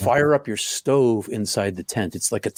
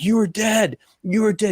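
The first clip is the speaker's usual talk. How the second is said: panicked friend; 10 semitones higher than usual. Retold or imitated. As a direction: shake him awake in a panic. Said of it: "He's like".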